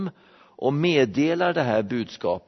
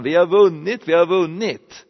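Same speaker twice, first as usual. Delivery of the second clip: raised, higher cry of victory; herald's cry; 6 semitones higher, 6 dB louder than usual. Acted. Messenger running into Rome calls to waiting crowd, shouted announcement. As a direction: call out the victory news like a herald. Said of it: "så ropar han".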